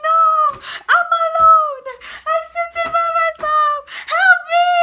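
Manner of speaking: high, loud, crying plea for help; friend screaming for help. Retold or imitated. Friend left alone on the ride, wailing high and loud. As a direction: wail like you're stranded alone on the ride. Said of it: "that's how he was screaming".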